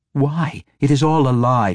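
high, urgent demand; heated pressing. A narrator's reading. Captor presses in heated exchange.

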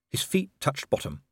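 plain reading voice

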